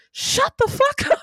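high, loud yell of fed-up irritation; exasperated mock shout. Viewer yelling at the annoying Guido, exasperated shout. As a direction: shout it high, as if silencing a pest. Retelling an exasperated reaction to the film.

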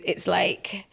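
normal talking voice

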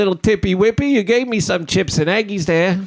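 silly put-on British voice. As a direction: play a goofy British waiter, high and bouncy. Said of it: "I'm not even doing an English accent anymore. I'm just going for voice".

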